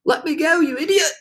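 high-pitched, indignant yell at moderate volume; mild narrated shout. A narrator's reading. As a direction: cry out crossly in a high centipede voice. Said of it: "shouted the centipede".

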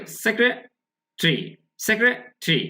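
"সেক্রেটারি" broken into sek-re, then tri; syllable-split pronunciation. Shown as a demonstration. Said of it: "বন্ধু খেয়াল করে এখানে".

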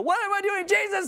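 very high, hammed-up panic; frantic cry. Preacher playing an overwhelmed believer crying to Jesus, frantic. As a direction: throw up your hands in mock alarm.

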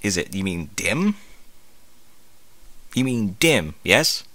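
ordinary delivery, nothing marked

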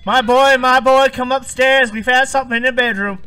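loud, high call up the stairs. Real, not acted. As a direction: shout for your friend to come up. Calling friend from upstairs.

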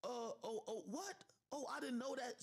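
imitating her fake surprise; mock surprise. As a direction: feign not knowing. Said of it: "now becomes".

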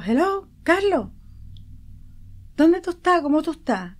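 high, loud, eager questions; excited surprise. Retold or imitated. Mother answering her dead son's call, high eager surprise. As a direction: burst out in disbelieving joy. Mother hears son in dream.

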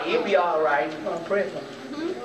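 ordinary talk, nothing marked

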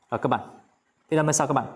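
usual speaking voice

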